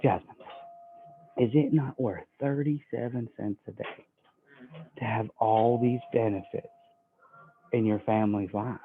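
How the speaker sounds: low, hushed appeal to a customer; soft sales close. Acted. Trainer shows how hard no is, soft sales close. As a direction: gently put the question, low and soft.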